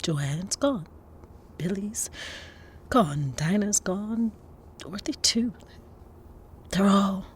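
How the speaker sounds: low, soft, halting roll call of loss; name each loss gently, with pauses; sorrowful. Acted. Consoling Lorraine by naming those gone, sorrowful. Listing the dead to the survivor.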